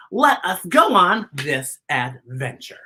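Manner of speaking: plain reading voice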